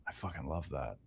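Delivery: breathless awed whisper. Retold or imitated. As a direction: whisper it in private awe. Quoting his private reaction.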